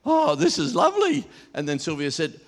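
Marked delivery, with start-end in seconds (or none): 0.0-1.2 much higher, delighted cooing; delighted gush. Retold or imitated. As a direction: coo it with delight. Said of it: "and she's like".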